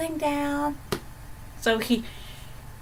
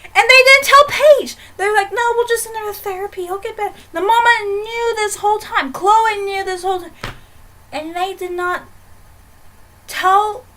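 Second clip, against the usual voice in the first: higher and louder, a worked-up rant; outraged; 9 semitones higher, 7 dB louder than usual. Real, not acted. Telling viewers how the family hid the truth, outraged. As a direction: vent at the family that hid everything.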